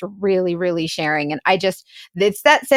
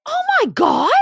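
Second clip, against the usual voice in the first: high-pitched, aghast gasp of words; shocked. Real, not acted. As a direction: react in horror to what you just heard. Host hears the chilling threat.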